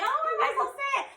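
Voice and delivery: high, quick puzzled thought; mock-bewildered inner voice; retold confusion. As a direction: pitch it way up, acting baffled. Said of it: "I was so confused".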